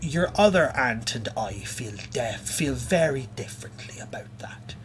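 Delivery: crone voice sliding into whispers; the witch aunt threatens the fey in a sly half-whisper. Acted. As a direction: hiss it like a hag keeping a secret. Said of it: "And the voice says".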